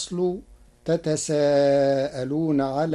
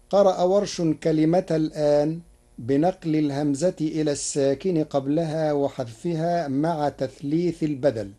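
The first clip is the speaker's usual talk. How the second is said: normal talking voice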